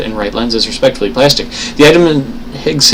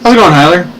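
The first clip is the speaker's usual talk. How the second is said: louder, higher call-out to a viewer; hearty greeting; 7 semitones higher, 5 dB louder, faster than usual. Real, not acted. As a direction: streamer shout-out, up and loud.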